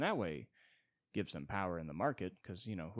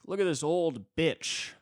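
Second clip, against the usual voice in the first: jeering guy; 9 semitones higher, 9 dB louder, slower than usual. Retold or imitated. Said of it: "some guy's like".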